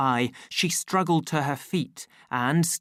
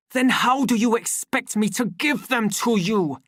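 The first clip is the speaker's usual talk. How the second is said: irritated demand; 9 semitones higher, 5 dB louder than usual. A narrator's reading. Savage arguing through door.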